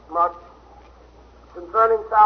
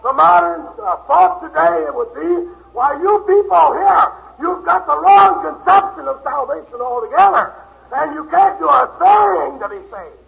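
a hint of a scolding preacher, louder; lightly voiced modern teacher waving people off; mildly mocking the modern doctrine; mock dismissive preacher; 7 semitones higher, 6 dB louder than usual. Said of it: "Peter didn't say".

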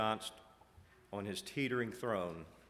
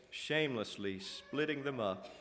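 ordinary delivery

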